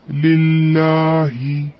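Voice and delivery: लिल्लाही with the last vowel held for two counts; lengthened vowel. Shown as a demonstration. Teacher showing students the two-count stretch, slowly recited. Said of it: "दो हरकत खींच के पढ़ेंगे".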